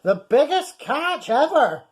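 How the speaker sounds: high, gloating skipper voice; smug skipper. Acted. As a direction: gloat over the catch. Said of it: "he thought with a smirk".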